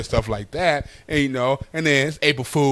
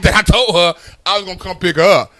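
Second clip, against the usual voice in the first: ordinary delivery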